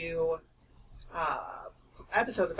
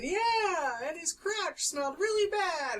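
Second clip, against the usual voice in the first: lightly mimicked high voice, overheard in public; a slightly higher, mock-caught remark; overheard vulgar remark; 10 semitones higher, slower than usual. Friend imitates herself being overheard saying something crude. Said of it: "And then you're, like, saying".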